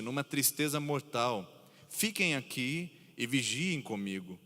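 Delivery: measured reverent scripture reading. Acted. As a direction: read the passage with reverent weight. Preacher reads scripture aloud.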